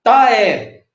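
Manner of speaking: "Taé" as one joined syllable; elisão. Shown as a demonstration. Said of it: "junta o tá, o é". ("Taé" emendado numa sílaba só)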